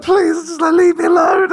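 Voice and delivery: high, loud begging; hammed-up cry to be left in peace; mock desperate plea. Acting out pleading with pestering stranger.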